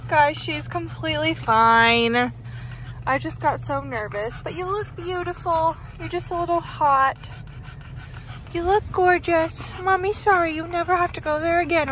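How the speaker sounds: high, loud, gushing voice for the dog; doting pet talk. Real, not acted. Comforting her dog after grooming.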